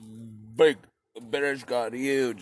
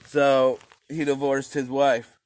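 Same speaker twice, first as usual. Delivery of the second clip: usual speaking voice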